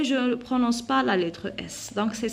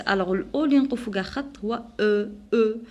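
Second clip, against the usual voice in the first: ordinary talk, nothing marked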